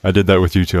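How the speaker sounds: ordinary delivery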